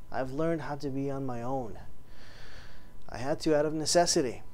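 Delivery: bitter loneliness. Acted. Boast turns to loneliness.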